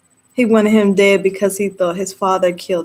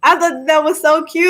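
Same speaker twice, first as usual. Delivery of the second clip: ordinary talk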